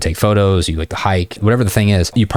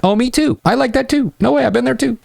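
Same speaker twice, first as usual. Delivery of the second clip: much higher, overeager me-too gushing; hammed-up needy guy. Shown as a demonstration. Coach mocks the needy reply to students, high and overeager. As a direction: gush like a try-hard. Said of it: "rather than being like".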